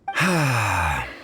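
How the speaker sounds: a long sigh out, letting the air go; weary sigh. Acted. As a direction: sigh as if caught out. Bartender caught out, ashamed.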